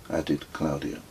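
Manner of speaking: usual speaking voice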